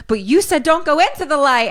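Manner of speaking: high, agitated objection; imitating an upset dad; acted frantic protest. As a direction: object in mock alarm. Retelling Dad's frantic protest.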